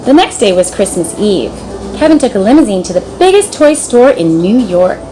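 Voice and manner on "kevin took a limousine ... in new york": ordinary delivery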